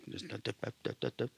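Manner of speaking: rapid tongue-tapped "dup dup dup", kept light; rough mimicry of a tongue firing off; imitated rattling tongue. Man shows congregation what his tongue did, imitated stutter. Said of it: "my tongue is doing this thing".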